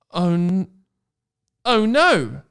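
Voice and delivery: hammed-up alarm; mock dread. Character dreads the surprise.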